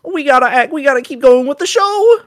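very high, loud cartoon Shaggy voice; hammed-up Scooby-Doo Shaggy voice. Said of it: "That was slightly terrible".

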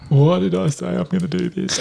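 put-on high whining kid voice, near tears; whiny kid. Dad imitates his tired son whining mid-run. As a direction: play the worn-out boy begging to stop.

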